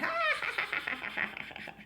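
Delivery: high airy hearty laugh; big breathy laugh. Acted. Grandpa laughing at stuck Fly, hearty high airy laugh. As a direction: laugh a little too hard. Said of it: "Grandpa laughed a little too hard".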